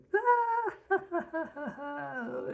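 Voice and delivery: high, loud maniacal cackle; shrieking villain cackle; hysterical laugh. Retold or imitated. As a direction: laugh like a madman. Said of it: "laughing hysterically".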